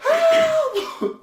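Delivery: mock desperate cry; hammed-up scream with a laugh in it; imitated panicked scream. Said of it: "panicking and screaming".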